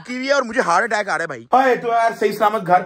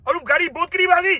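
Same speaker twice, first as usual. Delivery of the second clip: mock rising panic; 8 semitones higher than usual. Panic as a car approaches.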